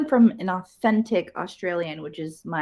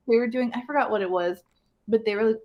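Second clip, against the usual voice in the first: ordinary talk, nothing marked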